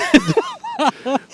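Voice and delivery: high giggling burst; burst of laughter. Real, not acted. Laughing at the running gag.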